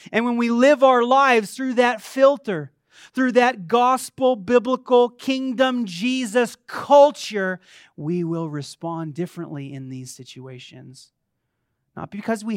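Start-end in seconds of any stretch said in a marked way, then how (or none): 0.1-7.6 raised voice, punching each word of the list; emphatic preaching. Real, not acted. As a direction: preach it out with conviction. Preaches at full force.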